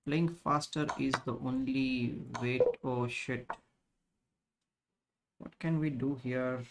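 usual speaking voice

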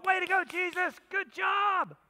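high, loud mock cheer of praise. Congregation cheering for Jesus, playful high shout. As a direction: cheer it on, a little hammed-up. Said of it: "And we go".

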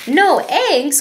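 mildly shocked question; a farmer gasping at the news; cried out. A narrator's reading. Reading to kids, the farmer cries out in mild shock. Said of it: "cried Farmer Brown".

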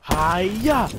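hammed-up martial arts battle cry; mock karate yell. Mock kung fu attack in game.

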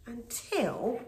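plain reading voice

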